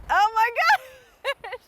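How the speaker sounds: slow, high alarmed oh my gosh; startled gasp. Real, not acted. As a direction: caught off guard by the wobble. Bridge swaying under her.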